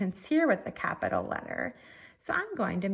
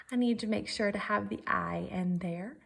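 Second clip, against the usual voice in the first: normal talking voice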